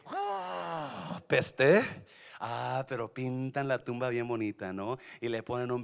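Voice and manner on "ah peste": disgust. Acted. Disgusted sound at rot.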